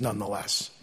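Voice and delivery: ordinary talk, nothing marked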